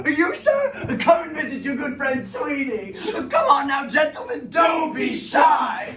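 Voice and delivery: calling out. Acted. Barber calling out to passersby.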